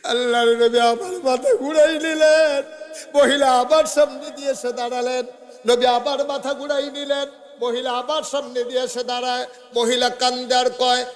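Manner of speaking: high-pitched chanting sermon delivery; impassioned preaching. A narrator's reading. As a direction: preach it high and urgent, holding line endings. Preacher's full-force chanted narration. (সুরেলা উঁচু গলায় ওয়াজ)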